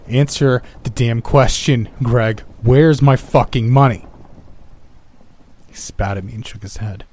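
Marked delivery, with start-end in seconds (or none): 0.1-4.1 slow, loud, rising to a furious yell; furious demand. Acted. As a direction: hammer the words out in a rage. I was starting to get frustrated.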